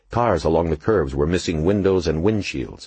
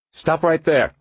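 high, raised order; firm command; 12 semitones higher, 4 dB louder, slower than usual. A narrator's reading. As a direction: stop them cold. Cop orders gang to halt.

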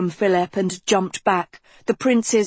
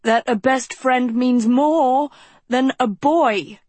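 slow, raised, holding back tears; fighting tears; 7 semitones higher, 4 dB louder than usual. A narrator's reading. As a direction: force it out through tears. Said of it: "fighting tears".

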